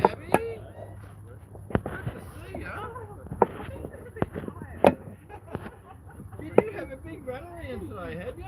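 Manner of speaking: pet talk. Real, not acted. Greeting the bird Gabby in a high cooing voice.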